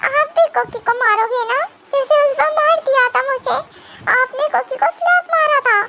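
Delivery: put-on a little girl remembering the slap; scared childlike. Cookie afraid. (डरी हुई बच्ची की आवाज़)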